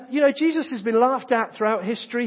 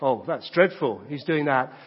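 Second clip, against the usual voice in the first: mock tut-tutting; 8 semitones lower than usual. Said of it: "others would look at and go".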